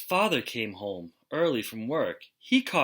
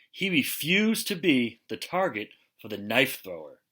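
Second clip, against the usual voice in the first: plain reading voice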